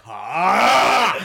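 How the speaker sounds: loud, high mock yell; imitated triumphant roar. Teasing her about the proud man holding her, mock roar. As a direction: bellow a drawn-out victorious "ah".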